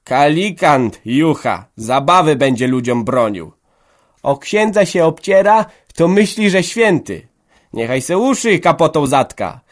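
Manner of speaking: loud, high, scornful heckling; hostile jeers. A narrator's reading. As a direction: shout down the killjoy. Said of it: "leciały nieprzychylne głosy". (głośne, wysokie, drwiące okrzyki przeciw organiście)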